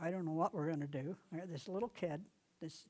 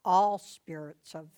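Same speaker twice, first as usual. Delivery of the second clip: much louder, slow, full authority; commanding voice; 2 semitones lower, 12 dB louder, slower than usual. Shown as a demonstration. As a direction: order the spirits out with power. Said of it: "put some authority in it".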